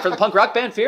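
high, loud questioning; retold excited disbelief; imitated amazed driver. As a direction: press the question like an astonished fan.

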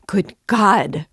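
alarmed cry. A narrator's reading. Mother shocked by her son's goodbye, crying out. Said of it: "cried Pulcheria Alexandrovna".

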